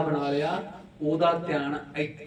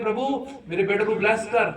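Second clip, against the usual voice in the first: high, loud pleading prayer, acted out; raised-voice mock prayer; imitating fervent prayer; 6 semitones higher, 7 dB louder than usual. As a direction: play a praying churchgoer bargaining with God. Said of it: "sister ਪ੍ਰਾਰਥਨਾ ਜਦੋਂ ਕਰਦੀ".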